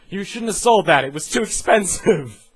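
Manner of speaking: loud, high mock reprimand; mock company scolding. Game company scolding a player for selling, loud and high. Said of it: "What happens if I sell things?".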